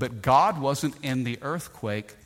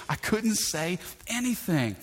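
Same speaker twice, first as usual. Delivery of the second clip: ordinary talk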